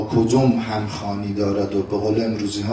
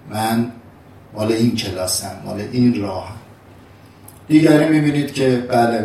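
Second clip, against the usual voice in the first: ordinary talk, nothing marked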